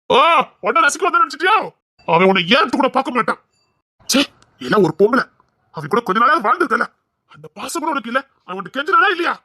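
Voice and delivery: heated, high-pitched accusations; angry scolding. Acted. Man berates a woman over his humiliated relative, angry. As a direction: berate with scornful questions, pitch jumping high.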